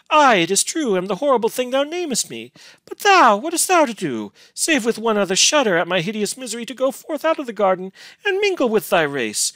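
high-pitched grieving reproach; grief beyond passion. A narrator's reading. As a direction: plead with a wounded heart. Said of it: "her grief was beyond passion".

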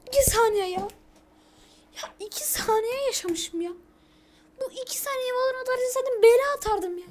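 indignant rant. Real, not acted. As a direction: gripe bitterly about dying so fast.

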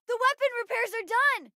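high, eager callout; excited report. Acted. Crew member reporting finished repairs, excited high callout.

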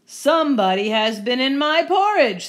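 loud, indignant big-bear outburst; Papa Bear. Acted. Papa Bear finding his porridge disturbed, loud indignant voice. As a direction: project it firmly, rising on the complaint. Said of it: "said Papa Bear".

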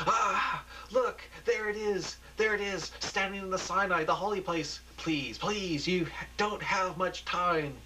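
high, slowed urgent plea; awed urgency. Acted. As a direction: point at the screen and beg them. Preacher announces the blast.

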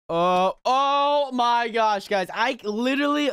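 high, drawn-out wail of frustration; slow, high cry of exasperation; exasperated. Real, not acted. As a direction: stretch it out, loud and fed up. Exasperated after failing.

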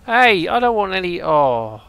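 high, loud plea falling away to a loud "oh"; shouted protest. Real, not acted. Player warding off other crews, shouting in protest. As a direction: call out to fend them off, then give up.

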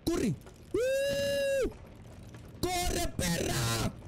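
high panicked cries while fleeing; panicked yelling. Real, not acted. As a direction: scream as they grab at you. Fleeing mannequins in the dark.

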